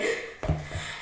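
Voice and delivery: soft, voiceless gasp; quiet mock gasp; acted gasp. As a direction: reenact a small shocked intake of breath. Retold reaction to positive test.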